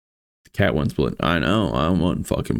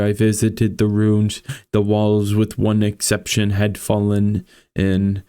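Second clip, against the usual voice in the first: measured, somber storytelling read; story narration; 4 semitones higher than usual. A narrator's reading. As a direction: read it like a gothic tale. Reads Poe story aloud.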